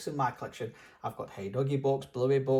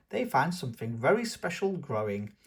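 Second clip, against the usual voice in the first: ordinary delivery, nothing marked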